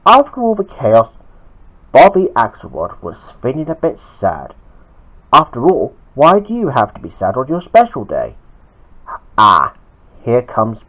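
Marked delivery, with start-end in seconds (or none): none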